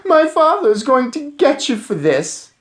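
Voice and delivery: high, loud bratty threat; furious bratty boy; shrieking threat. A narrator's reading. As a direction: shriek it like a spoiled kid. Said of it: "he had leapt up shrieking".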